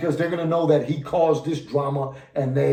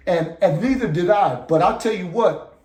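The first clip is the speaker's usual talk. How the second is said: usual speaking voice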